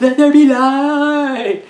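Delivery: very high, loud, slow, overdone proclamation; bad acting. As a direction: drag the line out, hammy and stiff. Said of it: "He actually delivers the line like that".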